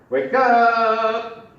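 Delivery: shouting at the sleeping puppet; loud wake-up shout. Shown as a demonstration. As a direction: yell into the trunk to wake him. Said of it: "You knocked harder".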